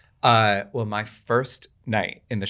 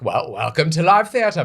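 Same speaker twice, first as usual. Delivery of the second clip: slow, louder, knowing tease; wry cast; 6 semitones higher, 6 dB louder than usual. Retold or imitated. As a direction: welcome the rookie with dry irony. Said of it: "they were like".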